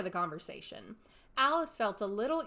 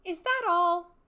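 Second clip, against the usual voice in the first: higher, louder indignant outburst; indignant Alice; 11 semitones higher, 12 dB louder, slower than usual. A narrator's reading. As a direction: burst out, barely holding anger. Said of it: "swallowing down her anger".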